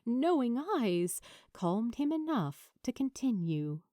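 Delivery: ordinary delivery, nothing marked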